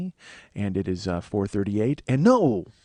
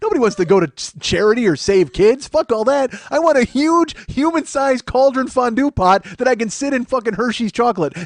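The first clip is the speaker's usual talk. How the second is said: high, loud, worked-up comic tirade; excited rant; 10 semitones higher, 5 dB louder than usual. Real, not acted. Host demanding a human-sized chocolate cauldron, high excited rant. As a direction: rant at high pitch, carried away with the idea. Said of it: "how excited I am".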